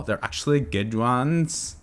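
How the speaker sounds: put-on slow, drawn-out Valley Girl vowels. Shown as a demonstration. Host mocking his own slip, slow Valley Girl drawl. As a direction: stretch "good ones" like a Valley Girl. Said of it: "said it like a Valley Girl".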